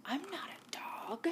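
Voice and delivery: sly whispered coyote. Acted. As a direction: breathe it mysteriously.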